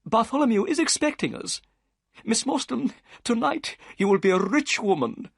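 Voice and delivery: high, eager gentleman's voice; Thaddeus Sholto. A narrator's reading. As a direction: lift it up as the anxious host.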